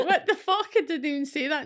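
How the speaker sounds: outraged you never said that; mock outrage. Reacting to subtitles putting words in your mouth, laughing outrage.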